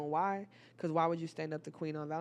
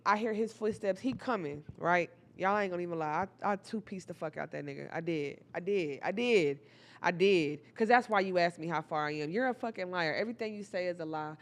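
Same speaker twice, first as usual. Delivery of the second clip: ordinary delivery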